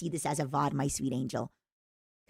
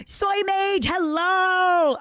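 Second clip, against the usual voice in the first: excited greeting; 12 semitones higher, 8 dB louder, much slower than usual. Real, not acted.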